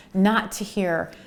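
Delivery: ordinary talk, nothing marked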